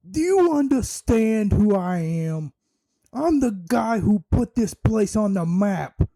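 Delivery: high, brash Brooklyn tough-guy voice; New York accent. Retold or imitated. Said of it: "Bully Ray has the best New York accent".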